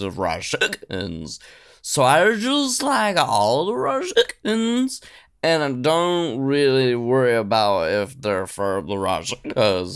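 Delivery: put-on slurred, slow drunk sliding up into falsetto; hiccupy drunken rambling; Woodrow Wilson as happy drunk. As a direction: stretch the words and swoop way up high. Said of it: "your favorite happy drunk".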